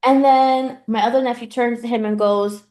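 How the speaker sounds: usual speaking voice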